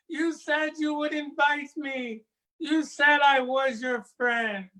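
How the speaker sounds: put-on girl bitten by the snake she trusted; cries out hurt. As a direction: wail at the broken promise. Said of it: "Amma cries out".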